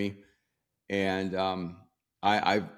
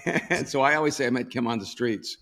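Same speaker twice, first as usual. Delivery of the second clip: ordinary delivery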